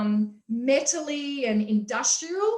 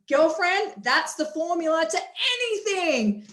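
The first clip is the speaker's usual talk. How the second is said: excited; 4 semitones higher than usual. Retold or imitated. Retold excited reply to friend.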